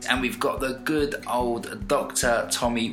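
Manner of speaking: loud, drawn-out host welcome; show intro. Real, not acted. Host opening the podcast to listeners, show intro.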